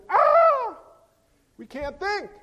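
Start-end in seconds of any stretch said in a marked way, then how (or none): 0.1-0.8 much higher, louder exasperated scream; mock frantic scream. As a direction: yell as if overwhelmed. Overwhelmed by beeping.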